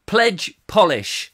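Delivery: ordinary delivery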